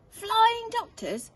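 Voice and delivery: high, loud, slow wonder; delighted discovery. Acted. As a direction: savour the new idea. Zog's excited idea.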